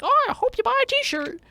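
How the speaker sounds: squeaky high mascot voice, loud; a chirpy high cartoon mouse pushing merch; corporate mouse. Theme park mouse mascot upselling visitors, squeaky high. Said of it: "is like".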